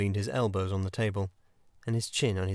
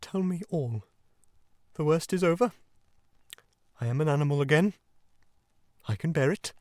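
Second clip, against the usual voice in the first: high, subdued, brave little voice; tearful murmur; 7 semitones higher than usual. A narrator's reading. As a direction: bear up forlornly, pitched high. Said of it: "he murmured presently".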